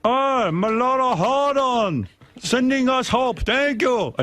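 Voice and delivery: slow, high bad Chilean accent; a hammed-up Chilean accent voice. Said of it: "I don't know how to do a Chilean accent".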